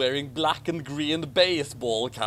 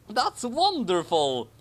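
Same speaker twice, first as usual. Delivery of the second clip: happy; 6 semitones higher, slower than usual. Acted. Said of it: "Harry reacted happily".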